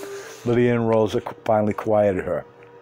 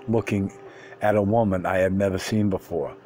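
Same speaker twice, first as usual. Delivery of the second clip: ordinary delivery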